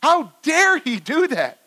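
high, slow indignant cry; worked-up outrage. Acted. Listeners worked up at the servant, slow high outrage. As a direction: stretch it out in loud outrage. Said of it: "getting all worked up at this point".

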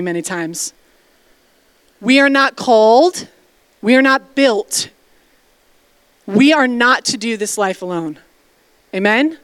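ordinary talk, nothing marked

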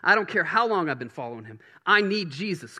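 fervent pulpit preaching; preaching at full force. Real, not acted. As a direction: preach it hard to the room. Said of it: "Come on, I'm preaching good".

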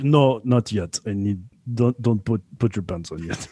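low imitation of a Russian doctor; rough Russian accent. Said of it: "a really good Russian accent".

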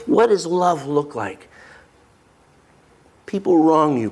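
normal talking voice

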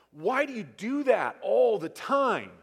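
put-on loud, high scolding at the kids; exasperated parent scolding kids; harsh scolding tone. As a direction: snap it like an irritated parent. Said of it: "one tone versus another tone".

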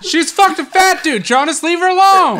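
high, loud teasing yell; mock outraged shout. Real, not acted.

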